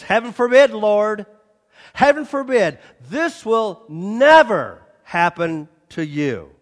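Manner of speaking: loud, insistent protest. Acted. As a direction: cry out Heaven forbid in loyal protest. Peter's loyal protest.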